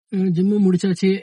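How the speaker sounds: ordinary talk, nothing marked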